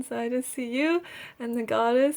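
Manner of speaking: ordinary talk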